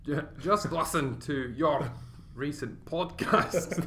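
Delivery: put-on slow, halting Scottish accent on alternate words; Scottish every other word. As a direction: labour over a bad Scottish accent, word by word. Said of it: "do it for every other word".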